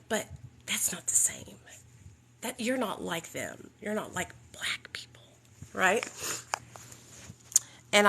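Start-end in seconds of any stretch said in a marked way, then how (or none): 0.1-6.1 hushed, confiding whisper; whispered excuse. Retold or imitated. Sponsor whispering that Nicole isn't like them. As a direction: lean in and whisper it.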